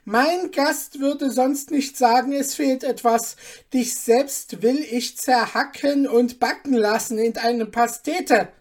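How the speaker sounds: a lord spitting accusation at his cook; raging outburst; furious duke. Acted.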